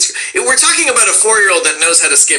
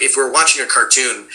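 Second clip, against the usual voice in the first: ordinary talk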